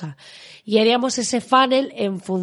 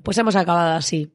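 ordinary talk, nothing marked

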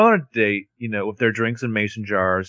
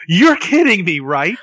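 high, loud disbelieving cry; incredulous outburst; 8 semitones higher, 6 dB louder, slower than usual. Retold or imitated. Spencer can't believe what her friend is telling her, high incredulous cry. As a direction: blurt it out in shock.

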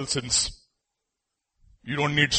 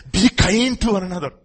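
loud, high, slowing scripture; fervent proclamation; 8 semitones higher, 8 dB louder, slower than usual. Real, not acted. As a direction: build it up to a passionate close.

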